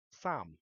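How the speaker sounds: "sam" said as a native Cantonese word, low. Shown as a demonstration. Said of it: "Cantonese is my native tongue".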